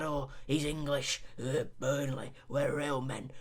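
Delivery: low, soft, grumbling northern football fan; a gravelly Burnley fan voice, kept low and hushed. Acted. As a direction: mutter like a weary Burnley supporter in the stands. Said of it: "do that gravelly voice".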